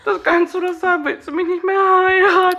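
high, pleading whine; imitating fiancé. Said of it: "Reaktion vom Igel?".

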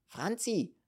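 high-pitched call for the waitress. A narrator's reading. Landlady calls Franzi the waitress, calling out. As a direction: summon someone across the room. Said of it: "rief sie dann".

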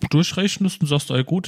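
ordinary talk

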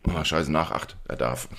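muttered. Retold or imitated. Muttered retold reaction.

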